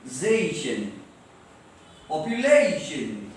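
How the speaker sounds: ZATION and POPULATION with "ZAY" and "LAY" stretched; stress before -tion. Shown as a demonstration. Teacher isolating the stressed syllable, stretched vowel. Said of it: "उसको जोर से पढ़ना चाहिए".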